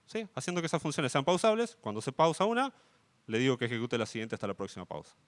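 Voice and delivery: ordinary talk, nothing marked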